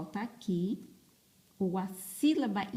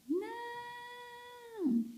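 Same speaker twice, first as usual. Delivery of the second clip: very high, soft little pupil voice; pupil answering; 7 dB quieter than usual. Acted. Teacher playing her pupils answering about the letter V, high pupil voice. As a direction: squeak out a drawn-out pupil's answer.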